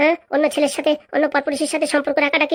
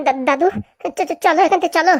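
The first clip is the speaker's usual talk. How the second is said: flustered, nervous plea; panicky stammer; 3 semitones higher than usual. Acted. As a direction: trip over first syllables, urging a retreat.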